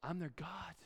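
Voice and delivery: soft, whispered close; hushed declaration. Acted. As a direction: drop to a solemn whisper. Hushed close of God's speech.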